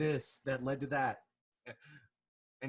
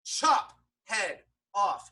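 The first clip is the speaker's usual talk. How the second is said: half-time, one word at a time; deliberate chop; 5 semitones higher, 4 dB louder, much slower than usual. Retold or imitated. Critic acting out the beheading beats, slow and emphatic. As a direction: spell out each blow slowly, pitched up. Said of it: "They should have been like".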